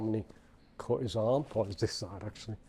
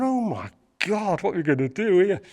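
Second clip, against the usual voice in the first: higher, louder alarmed thought; a burst of mock panic; panicked thought; 7 semitones higher, 8 dB louder than usual. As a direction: gasp it out in panic.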